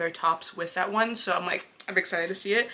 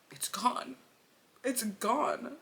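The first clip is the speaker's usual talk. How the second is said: high, slightly breathless fake sob; mock crying; 4 semitones higher, 4 dB quieter, much slower than usual. User mourning TikTok as a joke, mock crying. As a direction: lament it as if tearful.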